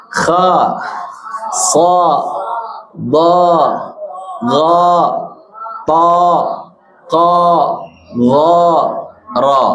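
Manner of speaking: each syllable with mouth wide open on a; teacher drilling open fathah syllables. Shown as a demonstration. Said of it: "harusnya mangap saja".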